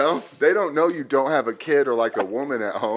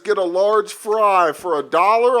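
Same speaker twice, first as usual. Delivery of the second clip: higher, louder, slow announcer-like; mock coupon read; 9 semitones higher, 7 dB louder, slower than usual. Reading a McDonald's coupon aloud, slow hammed-up voice. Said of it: "I got another one that says".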